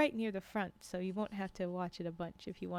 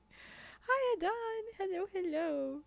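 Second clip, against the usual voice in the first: very high singsong hello; high-pitched greeting; 12 semitones higher, much slower than usual. Real, not acted. Streamer spots Don arriving mid-story, high-pitched playful greeting. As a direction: greet them way up high in your voice.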